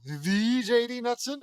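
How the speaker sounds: drawn-out, high, loud disbelief; starstruck gasp. Acted. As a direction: gush like a starstruck fan. Starstruck fan recognizing a hero.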